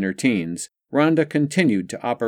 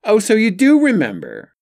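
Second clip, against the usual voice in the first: high, loud gleeful gotcha; sly triumphant exclamation; gloating; 12 semitones higher, 7 dB louder than usual. A narrator's reading. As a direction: crow it like a victory. Rob gloats.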